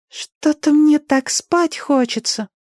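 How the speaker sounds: put-on high, slow child's voice; sleepy boy. A narrator's reading. Danny's eyes rolling as he fades, sleepily. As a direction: drift drowsily like a small boy.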